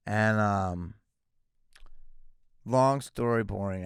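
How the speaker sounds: ordinary delivery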